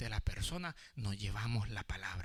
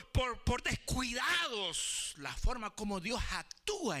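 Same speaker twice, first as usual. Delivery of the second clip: urgent, raised pulpit voice; fervent preaching; 13 semitones higher, 5 dB louder than usual. Real, not acted. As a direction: press the lesson on the congregation. Preaches with force.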